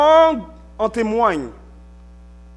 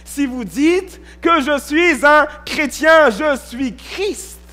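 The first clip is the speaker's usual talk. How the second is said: preaching; 5 semitones higher than usual. Acted. Preacher urging the congregation to keep the commandments. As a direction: preach it high and insistent.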